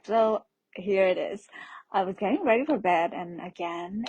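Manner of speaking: ordinary delivery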